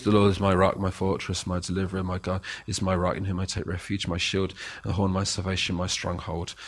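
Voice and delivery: low, soft, going-through-the-motions reading; detached rote reading. Shown as a demonstration. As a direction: read it flat like a chore. Flat rote reading of scripture.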